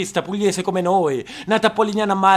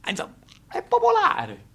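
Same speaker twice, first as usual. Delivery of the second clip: ordinary talk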